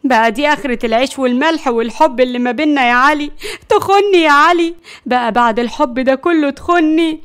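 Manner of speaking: wounded, indignant accusation; exasperated reproach. Acted. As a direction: reproach a betraying husband bitterly. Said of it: "وهي بتقول بغيظ".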